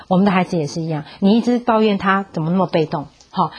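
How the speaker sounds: normal talking voice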